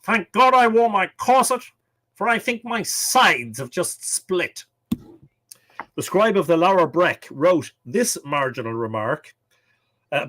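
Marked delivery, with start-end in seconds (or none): 0.0-4.6 high-pitched mock-theatrical quote; imitated sitcom character, high and deliberate; Blackadder. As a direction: declaim the sarcastic line high, slowing at the end. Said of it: "As Blackadder once said".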